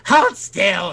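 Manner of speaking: high, loud villainous command; menacing mother. Acted. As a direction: order your squirming victim about as a monster mother. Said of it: "she's whispering horrible things and laughing".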